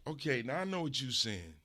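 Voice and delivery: ordinary talk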